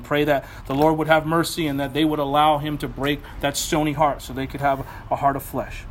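ordinary talk

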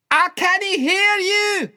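very loud, high, drawn-out yell; full-force child's shout over ringing ears. Acted. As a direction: bellow it slowly as if deafened. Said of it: "I shouts".

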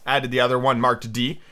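ordinary delivery, nothing marked

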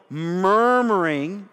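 MURMURING stretched out slowly; slow, onomatopoeic "murmuring"; really slow. Shown as a demonstration. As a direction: draw out the first syllable of "murmuring". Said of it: "if you say it really slow".

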